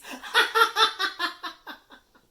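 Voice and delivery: very high shrieking cackle; wild poltergeist cackle. A narrator's reading. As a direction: cackle madly as you fly off. Peeves whooshing away laughing.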